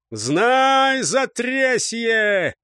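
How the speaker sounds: high, loud, drawn-out yell; battle cry. A narrator's reading. Squad charges the enemy crowd, battle cry. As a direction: roar it out as you charge.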